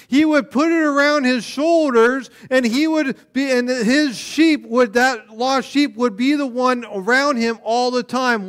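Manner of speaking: loud, high, driving sermon voice; a pastor pressing the lost sheep parable home; preaching at full force. Acted. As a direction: build the story up with urgency.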